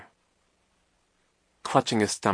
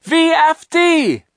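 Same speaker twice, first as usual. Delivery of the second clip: very high, drawn-out scream; long, loud, desperate yell; 12 dB louder, much slower than usual. A narrator's reading. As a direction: scream each letter slowly at full voice. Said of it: "he managed to scream again".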